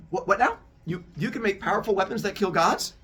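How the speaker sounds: high, loud, stammering disbelief; stunned amazement. Acted. Zeus amazed that the Cyclops make god-killing weapons. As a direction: trip over your words in astonishment.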